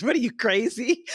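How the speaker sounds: high, far-off mock disbelief; teasing friend. Friend teasing her about working in recovery, high mock disbelief. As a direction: tease her like she's lost her mind. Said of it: "He says".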